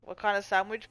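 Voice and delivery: ordinary talk, nothing marked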